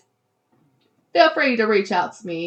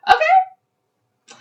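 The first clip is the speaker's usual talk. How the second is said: very high, loud, stretched squeak; sassy okay; 6 dB louder than usual. Pretend. Host caps her hometown point, sassy squeak. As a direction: shoot way up as a playful challenge.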